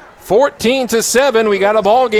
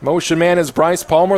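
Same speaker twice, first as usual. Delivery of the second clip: ordinary talk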